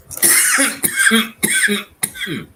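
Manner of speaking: loud hacking cough; coughing fit. Real, not acted. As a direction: cough hard, clearing the chest. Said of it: "I'm just catching my breath".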